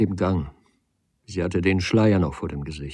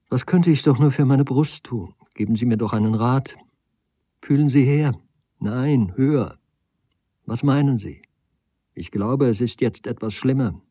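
higher, anxious lady asking for reassurance; fretful lady; 5 semitones higher, 5 dB louder than usual. Acted. Lady asks Nagel about her chest and hair, fretful. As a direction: voice an agitated woman fussing over herself.

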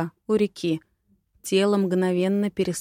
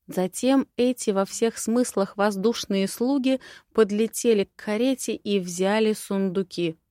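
ordinary delivery

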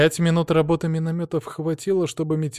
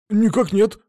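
soldier's report; 9 semitones higher, 6 dB louder than usual. A narrator's reading. Soldier answers prince.

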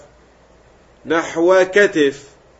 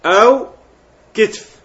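أو كتف lifted high and loud, كتف as kitf; teacher stressing the second shortened form; emphatic alternative; 7 semitones higher, 6 dB louder than usual. Shown as a demonstration. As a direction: call out أو كتف to mark the other form. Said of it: "تخفف فيقال كتف".

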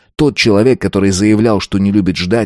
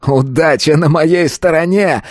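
high, slow exultant cry; gloating; 8 semitones higher than usual. A narrator's reading. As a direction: crow over the lucky roll. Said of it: "захохотал тот". (торжествующе, высоко и протяжно)

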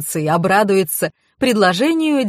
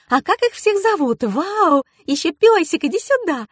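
put-on very high girlish excitement; high-pitched cooing enthusiasm; gushing delight; 9 semitones higher than usual. A narrator's reading. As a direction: fuss over cute doggies in a high voice. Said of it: "зааплодировала девушка".

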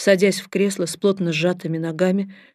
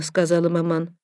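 usual speaking voice

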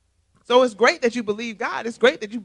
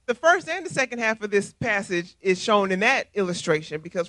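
ordinary delivery

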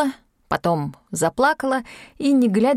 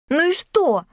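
higher-pitched offended retort; 9 semitones higher, 3 dB louder, slower than usual. A narrator's reading. Yulka answers her friend's reproach, offended. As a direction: answer back, mildly stung. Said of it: "обиделась Юлька".

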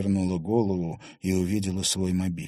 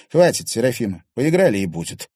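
loud, harsh cutoff; hard and cold; 4 semitones higher, 8 dB louder than usual. A narrator's reading. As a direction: slam the door on her. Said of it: "неожиданно жёстко проговорил Клим". (жёстко и громко)

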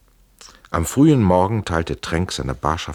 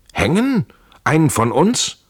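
indignant outburst; 9 semitones higher, 4 dB louder than usual. A narrator's reading. Trenck bristles at Schell's talk of hanging, sharp and indignant. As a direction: voice jumps high in sharp disbelief.

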